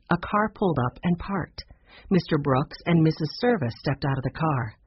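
plain reading voice

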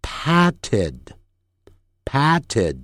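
"patted" very slow, each syllable drawn out; slow-speed pronunciation. Shown as a demonstration. Teacher showing learners slow reading of "patted", slow and deliberate. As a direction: say "patted" slowly, final d sounded. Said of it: "慢速念的时候是".

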